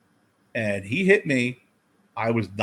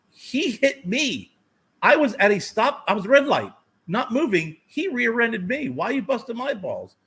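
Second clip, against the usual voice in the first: indignant protest; 11 semitones higher than usual. Retold or imitated. Driver protesting to a cop that he was rear-ended, high and indignant. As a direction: plead your case in a high, exasperated voice.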